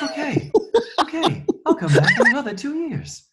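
high, airy, laughing Eddie impression; breathy voice. Shown as a demonstration. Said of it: "in the breathy voice".

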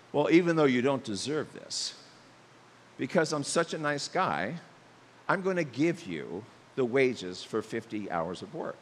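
a hint of a smug boss, low-pitched; condescending boss. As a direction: talk down to the worker gently. Boss answering the worker.

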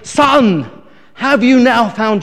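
loud, slow, insistent pastor demand; upset pastor shouting. Retold or imitated. Upset pastor to drunk after third dunking, slow loud shout. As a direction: bellow the question at the soaked drunk. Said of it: "really upset that it wasn't working".

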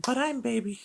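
put-on very high, squeaky baby voice. Echoes partner in a baby voice.

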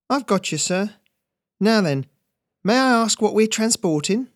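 put-on high, knowing young dockhand; keen and conspiratorial; young Ferret. Ferret plays along.

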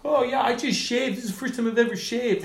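very high, goofy drunk-guy imitation; drunk heckler. Drunk owner's kid interrupting the comic, high mock drunken voice. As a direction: mimic a ripped guy bragging, voice pushed way up. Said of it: "he's drunk, he's, like, ripped".

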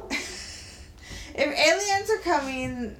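slow, laughing through the words. Real, not acted. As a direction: laugh while repeating it slowly.

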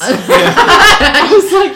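high, slow laughing words. Real, not acted. Laughing hard.

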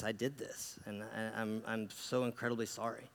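low, very soft retold apology; guilt-laden confession. Man confessing his guilt to Jesus, hushed and remorseful. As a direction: confess it quietly, weighed down by guilt. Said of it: "weighted over by this guilt".

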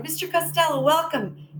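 eager welcome. Retold or imitated. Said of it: "And I was like".

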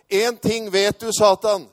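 high, very loud retort; shouting back. Retold or imitated. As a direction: shout it defiantly at the enemy. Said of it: "Og så ropte jeg til han".

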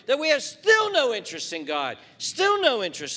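loud, high, driving sermon voice; full-force preaching. Acted. Pastor pressing the congregation about sin, loud preaching.

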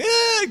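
high mock crying squeal; put-on high tantrum cry; imitated toddler wail. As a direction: mimic a worn-out child's wail. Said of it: "she's been screaming for ten minutes".

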